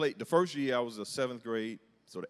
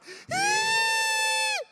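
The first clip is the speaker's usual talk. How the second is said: loud, very high held shriek; mock rage squeal; 5 dB louder than usual. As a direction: squeal with hammed-up fury. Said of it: "I'm just angry".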